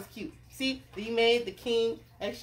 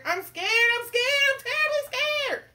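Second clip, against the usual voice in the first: very high, loud, escalating wail; terribly scared cook; 8 dB louder than usual. Acted. Cook declares his terror of fishing, hammed-up panic. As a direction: climb into hammed-up cartoon panic. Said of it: "the cook declared".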